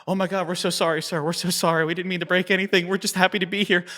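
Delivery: flustered apology. Retold or imitated. Crew apologizes to the founder.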